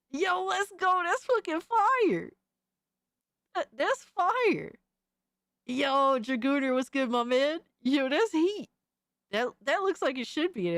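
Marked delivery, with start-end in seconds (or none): 0.1-8.7 high-pitched hype reaction; hyped. Real, not acted. Streamer hyping up fan art he likes, hyped. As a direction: cheer it on in a raised, excited voice.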